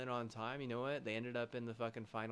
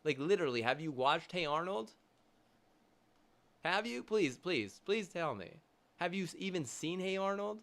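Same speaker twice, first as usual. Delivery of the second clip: louder, higher, pleading and incredulous; near-shouted please, have you seen it; exasperated demand; 7 semitones higher, 6 dB louder than usual. Real, not acted. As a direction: push the question at them in disbelief. Ranting, demanding an answer.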